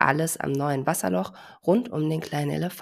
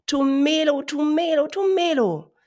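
loud call; 11 semitones higher, 6 dB louder than usual. Acted. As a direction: shout out the new name. Elephant repeats his name.

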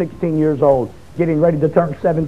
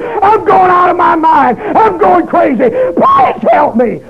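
desperate begging; 7 semitones higher, slower than usual. Retold or imitated. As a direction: beg for help, breaking into a cry as it builds to a scream. Begging the psychiatrist for help.

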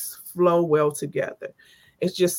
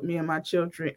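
ordinary talk

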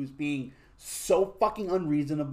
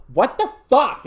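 high, loud, furious "what the fuck"; retold angry outburst; 11 semitones higher, 11 dB louder, slower than usual. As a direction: blast it like the angry girlfriend on the phone. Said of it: "she goes".

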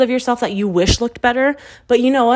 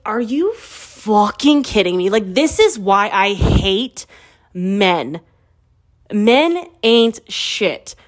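slow, emphatic indignation; outraged disbelief; much slower than usual. Retold or imitated. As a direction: drag out the words in exasperated outrage. Outraged reaction to discovered cheating.

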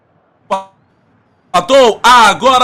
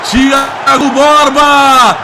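explosive goal commentary; 3 semitones higher, 4 dB louder, slower than usual. Acted.

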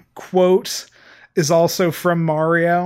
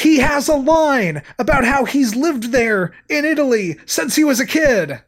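put-on Bronx accent; 8 semitones higher, 4 dB louder than usual. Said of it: "thick Bronx accent".

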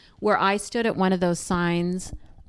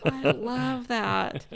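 real laughter through slow words; laughing; 4 semitones higher, much slower than usual. Real, not acted. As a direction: talk through delighted laughter. Laughing at own punchline.

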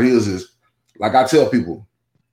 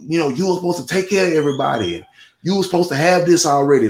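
higher-pitched mock tirade from grandma; imitating an angry grandmother, pitched up; retold cussing out; 6 semitones higher, faster than usual. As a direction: rattle it off like a scolding grandma. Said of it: "she was cussing me out".

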